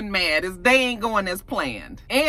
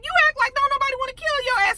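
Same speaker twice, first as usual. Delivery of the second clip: much higher, fast, mocking disbelief; incredulous scolding; much faster than usual. Real, not acted. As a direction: squeal it like you can't believe him. Scolding careless character at screen.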